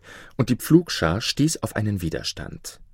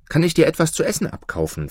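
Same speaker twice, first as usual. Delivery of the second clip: higher, quick eager ask; eager question; 8 semitones higher, faster than usual. A narrator's reading. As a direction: rush the request up in pitch. Hungry Maruf asks farmer for food.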